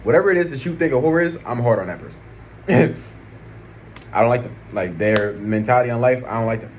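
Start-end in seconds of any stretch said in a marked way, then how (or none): 2.7-3.6 quick amused laugh; a brief laugh breaking out; short laugh. Real, not acted. Laugh alone.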